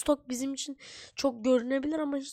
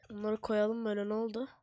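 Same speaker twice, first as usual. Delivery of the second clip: ordinary delivery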